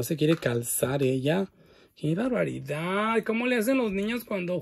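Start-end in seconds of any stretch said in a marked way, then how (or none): none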